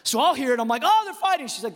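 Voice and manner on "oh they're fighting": high-pitched worried outburst; mock alarm. Dad hearing his boys scream, mock alarm. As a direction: react in alarm, pitch jumping up.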